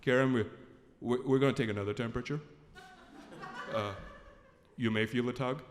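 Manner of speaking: low, steady doctor voice; imitated doctor giving notice in a deep monotone; matter-of-fact, deep-voiced medic. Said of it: "then the doctor comes in".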